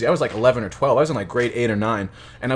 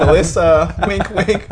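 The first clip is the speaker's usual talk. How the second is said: creepy teacher voice; 5 semitones higher, 4 dB louder, slower than usual. Retold or imitated. Teacher winking at girl, mocking.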